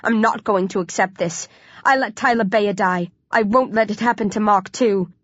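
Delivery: plain reading voice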